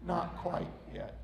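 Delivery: very soft, low reply; quiet, gentle, unmoved refusal; smiling potter. Acted. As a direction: answer in a hush with a patient smile. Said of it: "the potter smiled and said".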